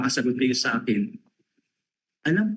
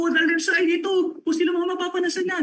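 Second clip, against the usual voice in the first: mock panicked shout; faster than usual. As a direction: blurt it out as if the bomb is about to go off. Imagined panicked self-talk.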